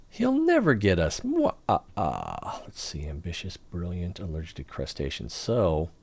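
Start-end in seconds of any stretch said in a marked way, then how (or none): 0.1-1.2 high, loud villain taunt; villain gloat. Acted. Gloating over the Lord of Evil. 1.2-2.6 villain laugh. Acted. Laugh after villain gloat.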